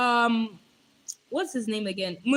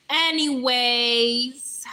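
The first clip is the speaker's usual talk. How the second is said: drawn-out anyways; 6 dB louder, much slower than usual. Real, not acted. Stretched filler word.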